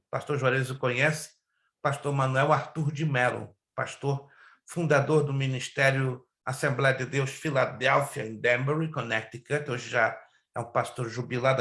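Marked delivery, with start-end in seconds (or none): none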